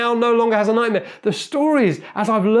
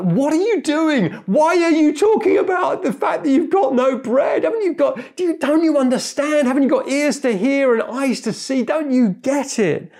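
high, exasperated telling-off; going off on them; 8 semitones higher than usual. Acted. Jesus catches disciples fretting over bread, exasperated rebuke. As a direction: scold them in pitched-up, incredulous outrage. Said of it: "he kind of goes off on them".

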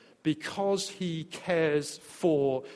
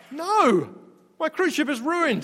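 higher, hammed-up dismay; mock whine; 7 semitones higher than usual. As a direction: lament like a spoiled tourist.

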